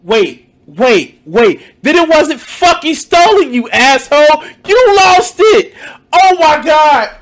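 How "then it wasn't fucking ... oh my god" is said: loud, higher furious yell; angry shout. Real, not acted. Caller berating streamer over lost phone, angry shout. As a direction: yell at him in disbelief.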